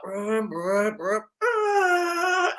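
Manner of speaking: high whiny car engine noises, rising sharply at the end; imitated engine revving. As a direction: mimic a BMW revving and wheelspinning. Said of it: "it's like".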